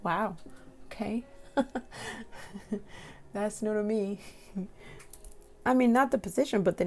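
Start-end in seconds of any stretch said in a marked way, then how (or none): 1.6-2.9 breathy embarrassed giggle; soft giggle. Real, not acted. Giggling at a silly position name, soft embarrassed giggle. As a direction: giggle under your breath.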